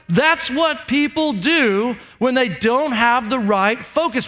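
ordinary delivery

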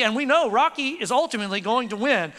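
ordinary talk, nothing marked

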